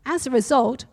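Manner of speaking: a preacher reading a story to his church. A narrator's reading. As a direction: tell the story with steady energy. Reads story aloud to congregation.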